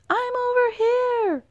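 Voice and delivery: high, loud, slow yell. A narrator's reading. Bullfrog yelling to the rescuers through rain, high and loud. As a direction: yell out to be found. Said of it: "he yelled".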